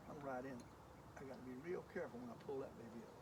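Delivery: hushed, low and very soft; real whispering. Real, not acted. Angler easing bait through brush near shallow fish, whispering. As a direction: keep it right down so the fish don't hear. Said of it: "And I know I'm whispering".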